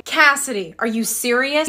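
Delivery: ordinary talk, nothing marked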